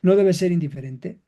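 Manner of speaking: normal talking voice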